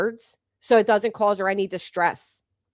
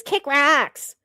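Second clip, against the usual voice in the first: higher mock of her catchphrase; mocking Reality; 5 semitones higher than usual. Vlogger parroting Reality's brush-off, mocking. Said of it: "Reality's response to everything is".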